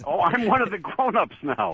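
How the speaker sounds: high-pitched comic character; high, silly young Jerry Lewis voice; goofy puppet voice. Acted. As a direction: pipe up like a goofy kid puppet. Puppet voice answers the host.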